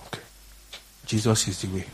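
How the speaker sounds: usual speaking voice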